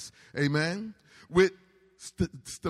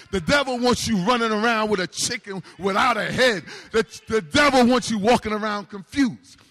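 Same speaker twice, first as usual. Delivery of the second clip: loud, higher, building preacher's cry; full force preaching; 5 semitones higher, 6 dB louder than usual. Real, not acted. Pastor rallying the congregation against the devil, loud and high. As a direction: raise the roof with it.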